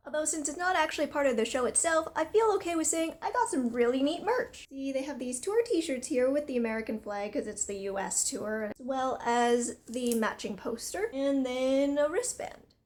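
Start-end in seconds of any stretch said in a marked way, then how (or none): 0.1-6.5 excited. Real, not acted. Excited about merch.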